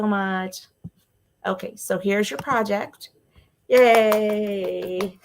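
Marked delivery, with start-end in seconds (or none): 3.7-5.3 happy laughing. Real, not acted. Viewer joined the stream.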